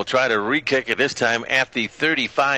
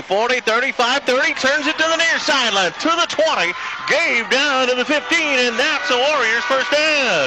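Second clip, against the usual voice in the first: excited play call; 9 semitones higher than usual. Real, not acted. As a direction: build the call higher yard by yard. Big run called live.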